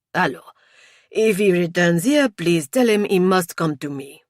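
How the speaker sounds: put-on loud, French-accented instruction; firm French-accented policeman; French inspector. A narrator's reading. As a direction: give orders with a French accent.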